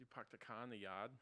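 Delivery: soft demonstration of a Boston accent; quiet mock-Boston question; Boston accent, dropped R's. Shown as a demonstration. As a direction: drop every R like a Bostonian. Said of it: "you dropped your R's".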